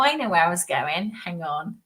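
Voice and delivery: ordinary talk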